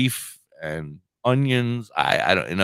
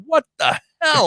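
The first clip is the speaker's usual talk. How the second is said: exasperated outburst; 3 dB louder than usual. Retold or imitated. Shocked by wife's change.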